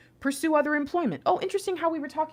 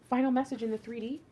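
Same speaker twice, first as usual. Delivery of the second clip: ordinary talk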